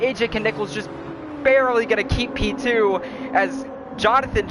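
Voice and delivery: winding down race call; play-by-play commentary. Acted. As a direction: read the results, easing off. Results after race.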